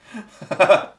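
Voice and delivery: loud, high burst of laughter; laughing. Real, not acted. Host amused by his own impression, loud laugh. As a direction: crack up at your own joke.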